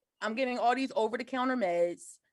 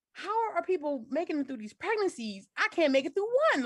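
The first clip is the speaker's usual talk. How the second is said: disbelieving inner voice; 6 semitones higher than usual. Retold or imitated. Despairing thought.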